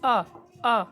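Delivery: آ with a sharp glottal catch; crisp glottal آ, آ; glottal stop. Shown as a demonstration. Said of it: "ہا اور حمزہ کی".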